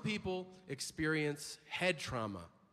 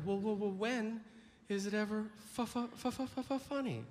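stammering, rising audience member; mock stuttering heckler; 4 semitones higher than usual. Comic mocking a stammering doubter in the crowd. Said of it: "you're like".

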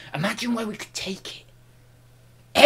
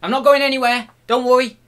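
emphatic promise; 7 semitones higher, 9 dB louder, faster than usual. Acted. Promising viewers he stays.

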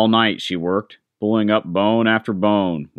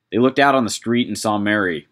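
ordinary delivery

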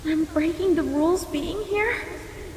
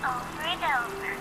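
much softer, distant echo; echoed repeat; 7 dB quieter than usual. Acted. Echo flower repeating the explanation, soft and distant. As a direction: fade it like an echo. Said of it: "It repeats the last thing it heard".

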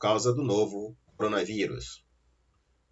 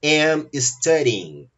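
am studying, slow, louder, stressed; stressed answer; 4 semitones higher, 4 dB louder, much slower than usual. Shown as a demonstration. Teacher revealing the correct answer to students, slow and emphatic. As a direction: announce the right answer slowly. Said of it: "A resposta correta é".

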